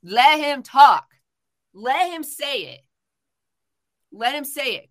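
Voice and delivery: demanding he be heard, each word pressed out; crowd calling out. Retold or imitated. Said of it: "people were like".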